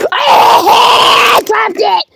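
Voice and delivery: very high, loud, slow cry; wailing shout. Acted. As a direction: howl it out in despair. Puppet drops its drink.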